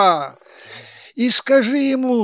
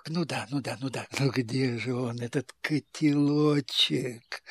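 quiet, low conspiratorial tone; hushed eager; 8 semitones lower, 12 dB quieter than usual. Acted. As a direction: ask softly, lower. Karabas whispering eagerly about secret.

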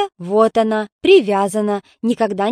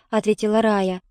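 ordinary delivery, nothing marked

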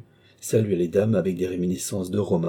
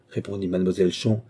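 plain reading voice

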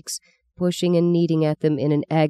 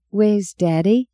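put-on little girl voice; 5 semitones higher, slower than usual. Meggie asks mother.

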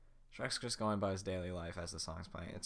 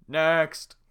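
loud high shout; guard calling; 8 semitones higher, 18 dB louder than usual. Acted. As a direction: call the next in line.

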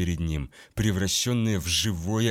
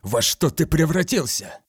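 horrified outburst; 6 semitones higher than usual. A narrator's reading. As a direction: recoil in disgust and demand. Garo confronts monstrous former commander.

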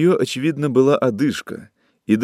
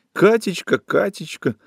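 angry mockery; 9 semitones higher than usual. A narrator's reading. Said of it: "говорила она, сердито комкая подушку".

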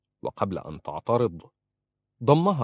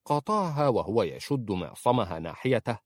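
ordinary delivery

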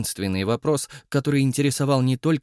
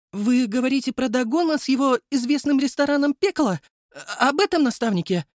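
put-on surprised young woman; 13 semitones higher than usual. Mila surprised by mentor news.

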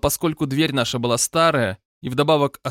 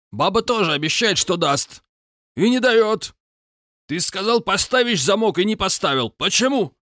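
angry Caucasian roommate; 7 semitones higher than usual. A narrator's reading. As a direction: berate him like a hot-headed roommate. Roommate berating narrator. (злобный упрёк соседа)

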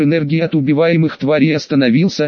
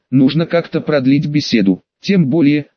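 ordinary delivery, nothing marked

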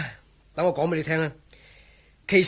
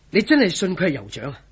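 usual speaking voice

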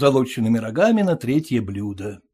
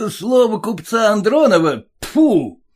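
a high, louder jeer, ending in a spit; scornful scoff; 8 semitones higher, 4 dB louder than usual. Acted. Groom mocking the father's merchant word, with a spit. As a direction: throw his own words back at him with contempt.

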